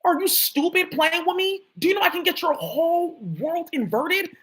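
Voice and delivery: high, slow, menacing challenge; slow high taunting threat; angry threat. Real, not acted. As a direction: dare them to cross you. Threatening an unseen enemy.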